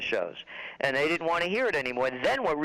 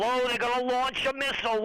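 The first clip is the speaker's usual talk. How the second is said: mock alarm, higher; 7 semitones higher than usual. Repeated mock hype, higher.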